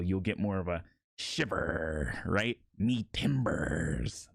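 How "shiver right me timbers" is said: low pirate growl with long rolled R's; growled pirate line. Shown as a demonstration. Teaching pirate voice, growling the line with stretched R's. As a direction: lower the voice and drag the R's. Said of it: "extend those R's and focus on that growl".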